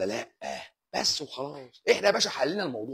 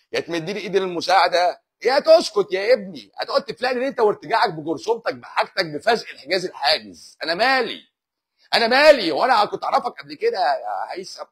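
louder exasperated outburst, rising to انا مالي; 6 semitones higher, 6 dB louder than usual. Real, not acted. Host snaps at viewer Haitham, exasperated and loud. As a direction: throw up your hands at the nagging viewer.

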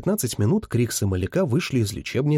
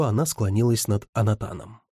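usual speaking voice

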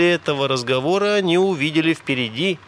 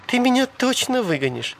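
high woman's voice; girlish, pitched-up doubt; wheedling question; 10 semitones higher than usual. A narrator's reading. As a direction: ask in a high, suspicious lilt. Sally doubts his promise.

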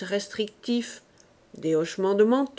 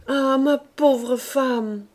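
tender pity; 4 semitones higher, 3 dB louder, much slower than usual. A narrator's reading. Husband's tender pity at birth.

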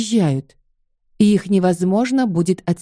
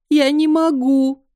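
slow, higher, breaking with tears; crying on the phone; 6 semitones higher, slower than usual. Acted. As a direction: wail it into the receiver. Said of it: "Я заплакала в трубку".

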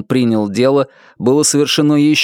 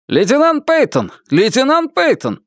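shouted call; 13 semitones higher than usual. A narrator's reading. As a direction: shout the lieutenant's name. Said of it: "прокричал офицер из диспетчерской".

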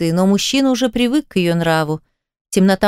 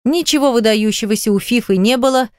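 usual speaking voice

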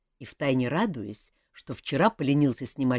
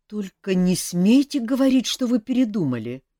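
louder, higher, alarmed plea; a touch of panic, begging her not to; mildly alarmed outburst; 8 semitones higher, 8 dB louder than usual. A narrator's reading. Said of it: "встревожился король".

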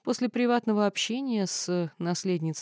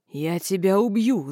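slow, louder death threat; yelled threat; 6 dB louder, slower than usual. Acted. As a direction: roar the threat at her. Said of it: "заорал Динар".

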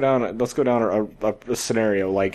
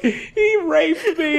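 high, slow mock outcry; hammed-up accusation, laughing through it; mock accuser; 11 semitones higher, much slower than usual. Fake victim bursting in on the boss, mock outcry.

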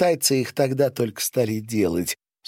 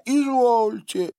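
very high, drawn-out reply; obliging servant; 4 dB louder, slower than usual. A narrator's reading. Attendant agrees to wash the guest, high obliging voice.

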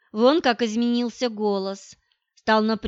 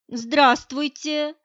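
slow, higher-pitched shy hello; timid; 5 semitones higher, 3 dB louder, much slower than usual. A narrator's reading. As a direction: greet him timidly, drawing the word out. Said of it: "произнесла она робким голосом".

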